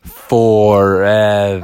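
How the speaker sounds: slow, drawn-out, eerie; spooky echo. Acted. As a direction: stretch it out like an echo. Echoing ominous word.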